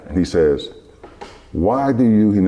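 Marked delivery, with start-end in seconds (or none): 1.5-2.3 higher-pitched imitation of Akeem's accent; imitated accent. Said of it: "in that, in that accent".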